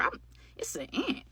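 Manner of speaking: breathy whisper, dawning surprise; whispered realization. Real, not acted. Realizes what bit her.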